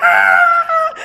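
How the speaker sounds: long, high, loud mock scream; reenacted scream. Storyteller acting out panic at the spirit, screaming. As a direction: scream at full pitch, acting it out. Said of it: "starts screaming".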